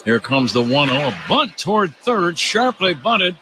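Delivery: ordinary talk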